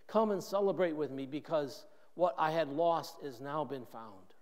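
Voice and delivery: ordinary delivery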